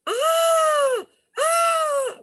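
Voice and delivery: high, loud wailing scream "ah, ah"; ghostly wail. Retold or imitated. Ghost screaming across the road.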